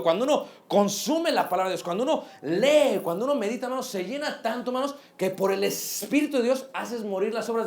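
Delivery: full-force preacher calling out to the brothers; fiery preaching. Acted. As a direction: preach it hard to the congregation.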